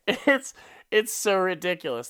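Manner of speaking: ordinary delivery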